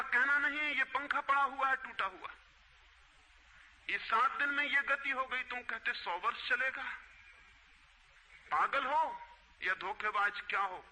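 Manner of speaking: acted scolding. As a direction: play an emperor berating the seller over shoddy goods. Emperor scolds over the broken fan. (ऊँचे सुर में सम्राट की फटकार का अभिनय)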